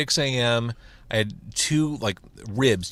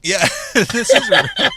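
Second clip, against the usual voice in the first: high laughing through the words; cracking up while agreeing; 9 dB louder than usual. Real, not acted. Laughing at spicy food.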